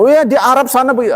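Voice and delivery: high, fast, airy mock of a casual excuse; mock dismissive quote. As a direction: toss it off like a flippant know-it-all.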